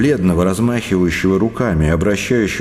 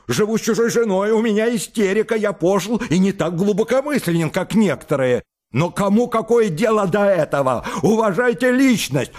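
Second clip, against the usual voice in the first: high, strained and overwrought; near-hysterical, voice climbing; a man at the end of his nerves; hysterical tirade; 10 semitones higher than usual. Acted.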